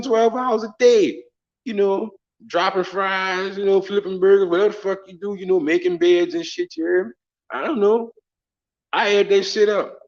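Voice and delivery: high, loud haggling tirade; exasperated acted-out telling-off; indignant rant. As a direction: retell the argument, worked up and pitched high. Acted quarrel over the price.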